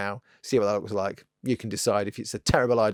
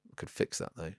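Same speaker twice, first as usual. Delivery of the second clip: breathy whisper, thinking aloud; whispered aside; 5 semitones lower, 12 dB quieter than usual. Real, not acted. Costumed painter musing to himself, in a whisper. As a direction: let the idea slip out under your breath.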